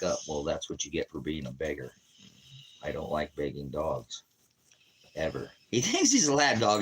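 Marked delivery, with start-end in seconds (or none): 5.7-6.8 high, loud amused burst; tickled that the huge dog wants a lap. Real, not acted. To viewers about his dog, high amused burst.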